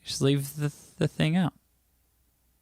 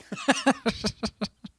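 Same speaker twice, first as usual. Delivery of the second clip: high laugh; 11 semitones higher, 3 dB quieter than usual. Real, not acted. Laughing at chat.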